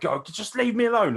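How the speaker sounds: high, whiny mock push-away; mock Pete Dunne brush-off. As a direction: shoo her off in a put-on high voice. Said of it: "He was like".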